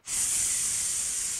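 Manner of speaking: long sustained hiss; snake hiss. Shown as a demonstration. Leading children in snake pose, long snake hiss. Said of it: "That's it, the snake".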